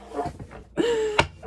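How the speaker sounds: high, spontaneous laugh. Real, not acted. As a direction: break into a real laugh, pitched well above speech. Laughs after naming Godfather.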